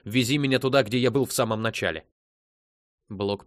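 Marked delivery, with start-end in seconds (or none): 0.0-2.1 loud, abrupt command; sharp, shouted order; barked. A narrator's reading. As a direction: cut in with a forceful bark. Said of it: "рявкнул Сет".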